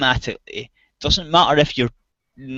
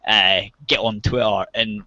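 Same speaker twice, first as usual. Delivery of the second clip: ordinary delivery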